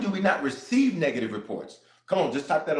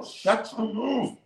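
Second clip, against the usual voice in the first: slow sassy shut-down; 6 semitones higher, much slower than usual. Pretend. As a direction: drag it out, dismissive and sassy. Retort to bad report.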